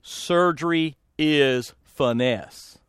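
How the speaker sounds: very slow, low, measured instruction; imitated surgeon. Surgeon peering at his assistant, slow low deliberate delivery. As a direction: space out each word, lightly voiced surgeon. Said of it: "It's just like that".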